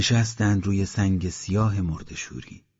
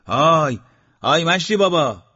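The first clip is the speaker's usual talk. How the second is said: loud, higher call with hands cupped round the mouth; shouting up; 8 semitones higher, 5 dB louder than usual. A narrator's reading. Eslam answers Mashti Baba on the roof, loud call. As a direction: yell up to the old man on the roof. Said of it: "دستش را گرفت دو طرف دهان و داد زد".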